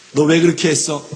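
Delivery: loud, demanding voice of God; God's stern question. Retold or imitated. God confronting a person about their sin, loud stern demand. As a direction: call the sinner to account.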